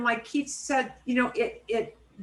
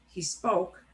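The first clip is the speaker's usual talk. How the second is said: ordinary talk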